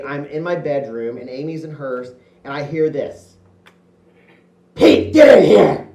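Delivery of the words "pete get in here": loud, drawn-out mock yell; imitating the sister yelling for him; sister's yell. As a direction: bellow it across the house like a bossy big sister. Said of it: "that's about how loud she always yelled at me".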